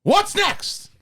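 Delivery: Pacino rant. Acted. Pacino rant bit continues.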